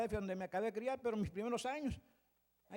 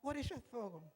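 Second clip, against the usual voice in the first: mock English accent, higher and slower; English-speaking sister; 5 semitones higher, 3 dB louder, slower than usual. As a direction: mimic a puzzled English-speaking churchgoer asking a question. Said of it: "una hermana cuando acabó me dijo".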